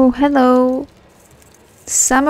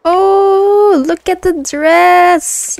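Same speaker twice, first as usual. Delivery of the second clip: excited; 5 semitones higher, much slower than usual. Real, not acted. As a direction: gush over it, slowly. Admiring the new dress.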